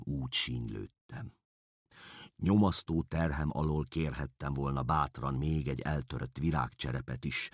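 plain reading voice

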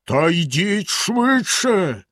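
slow, menacing roar; bellowing. A narrator's reading. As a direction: shout her down again. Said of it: "знову заволав синя борода".

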